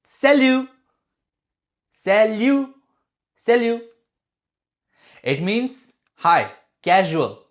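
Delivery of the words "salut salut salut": salut as sa-LU, final t silent; teacher demos salut for learners; model pronunciation. Shown as a demonstration. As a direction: say salut brightly, no t at the end. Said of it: "Number three".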